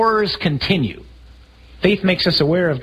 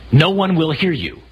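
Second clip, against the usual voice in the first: usual speaking voice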